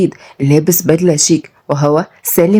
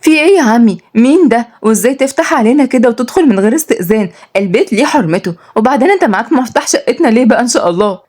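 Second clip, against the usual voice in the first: put-on higher, indignant young woman; shocked; 5 semitones higher than usual. Niece confronts uncle who walked in unannounced, shocked. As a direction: demand answers, shocked and pitched up. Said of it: "وقالت بصدمة".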